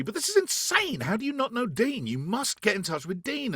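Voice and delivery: high, exclaiming disbelief; incredulous friends. Retold or imitated. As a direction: insist it is crazy they've not met. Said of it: "they're always saying to me".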